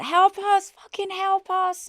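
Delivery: high desperate cry for help; high panicked plea, slow on the second help; shouting for help. Retold or imitated. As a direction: scream to the car ahead. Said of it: "like this".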